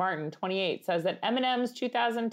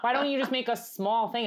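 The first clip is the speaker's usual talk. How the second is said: put-on Long Island accent; 5 semitones higher than usual. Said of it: "an Italian accent now?".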